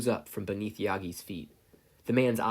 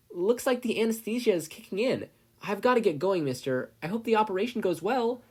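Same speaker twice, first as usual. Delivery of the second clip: put-on high, loud kid yelling goodbye; yelled; 11 semitones higher, 4 dB louder than usual. A narrator's reading. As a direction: call it out while running off. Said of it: "Izuku yelled".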